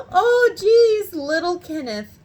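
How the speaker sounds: loud, high squeal, falling at the end; gushing adoration. Retold or imitated. Customer hearing about little Kenneth's smile, adoring. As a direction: squeal as if over something adorable. Teller overcome by cuteness.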